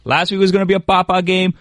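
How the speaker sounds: ordinary delivery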